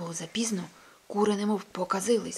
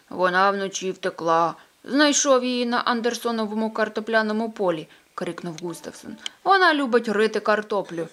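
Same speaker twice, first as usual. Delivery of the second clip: neighbour Gustavson; 8 dB louder than usual. Acted. Gustavson's character voice.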